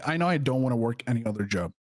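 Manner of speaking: usual speaking voice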